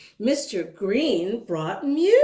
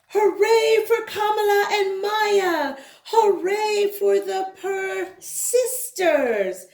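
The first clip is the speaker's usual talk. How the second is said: high, loud hooray; big celebratory hooray; cheering; 8 semitones higher, 4 dB louder than usual. Acted. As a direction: cheer them on. Cheering the girls.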